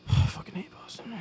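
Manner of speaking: a hushed, breathy whisper of contempt; sighing whisper, fed up with Boston; whispered disgust. Real, not acted. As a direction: whisper it like you really mean it.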